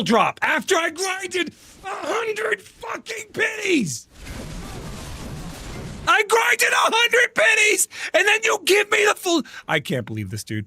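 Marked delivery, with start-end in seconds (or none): 0.4-9.4 furious gamer wail; angry rant. Real, not acted. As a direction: stretch out the outrage, pitched way up. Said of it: "I'm angry".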